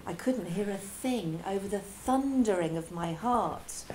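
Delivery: ordinary delivery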